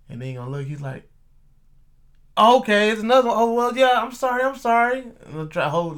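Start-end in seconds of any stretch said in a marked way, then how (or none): 2.4-5.0 retold over-eager sorry after being wrong; flustered apology. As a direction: imitate a loud, high, hurried backing down. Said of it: "oh, demeanor try to change".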